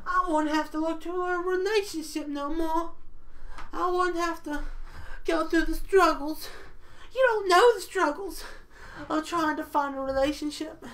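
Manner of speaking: high-pitched put-on voice of an impressed listener; squeaky mimicry of a fan being won over; imagined audience member. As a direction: pitch it way up, mock enthusiastic agreement. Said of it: "all of you are also like".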